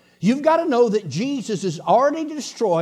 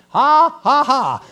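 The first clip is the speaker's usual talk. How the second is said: loud, higher mock laugh; laughing at the devil; 5 semitones higher, 8 dB louder, much slower than usual. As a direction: answer with forced, taunting "ha"s. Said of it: "I'm a laughing at you".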